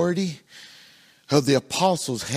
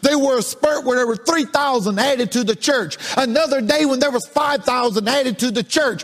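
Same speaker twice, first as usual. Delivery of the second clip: raised, sing-song preaching build; 8 semitones higher, 3 dB louder than usual. Acted. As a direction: lift the congregation with rising fervour. Preaching at full force.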